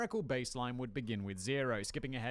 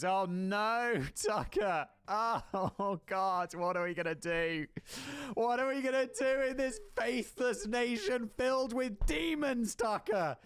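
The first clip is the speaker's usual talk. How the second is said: mock panic; 9 semitones higher than usual. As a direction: wail in fake terror. Mock panic mimicking Tucker's worry.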